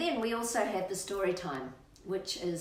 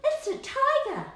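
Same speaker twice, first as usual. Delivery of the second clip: put-on high, excited child calling out; mock child voice, pitched up; kid's excited voice; 12 semitones higher, 5 dB louder than usual. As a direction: squeal it like a small kid spotting a tiger. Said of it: "They go".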